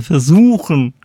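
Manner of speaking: "versuchen" stressed with weight; Betonung auf versuchen. Real, not acted. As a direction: lean firmly on the word "versuchen". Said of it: "ich betone versuchen". ("versuchen" nachdrücklich betont)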